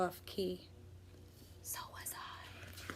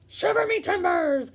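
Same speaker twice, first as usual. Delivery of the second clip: high, loud pirate cry; pirate exclaiming; 7 semitones higher, 5 dB louder than usual. Acted. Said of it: "the pirate said".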